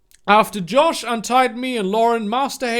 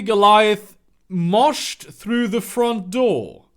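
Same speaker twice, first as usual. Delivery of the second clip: normal talking voice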